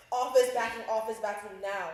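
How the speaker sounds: suddenly loud, insistent retold plea; urgent demand. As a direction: repeat it forcefully, like an emergency text. Retold frantic text begging friends for help.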